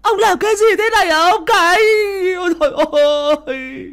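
very high, loud horrified shriek; screaming. Acted. Wife seeing the smashed shrine, shrieking at her husband. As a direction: scream out in dismay, trailing off at the end. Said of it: "bà ấy gào lên".

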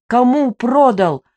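the narrator barking into the sleepy widow's ear; barked. A narrator's reading. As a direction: bark it right into her ear. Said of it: "рявкнула я ей прямо в ухо".

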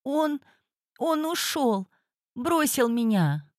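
high, quivering, close to tears; trembling voice. Acted. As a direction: she can barely get it out, trembling. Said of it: "дрожащим голосом произнесла клиентка".

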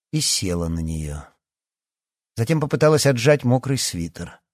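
ordinary delivery

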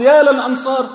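imitated battlefield crier at full pitch; intensifying herald's call. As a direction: cry out the summons as a herald would. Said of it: "فلما اشتد المنادي وجعل يقول".

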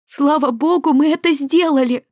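high, loud shout of triumph; overflowing with relief and joy; joyful exclaim. Acted. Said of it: "сияющими глазами воскликнула Наоми". (ликующий возглас)

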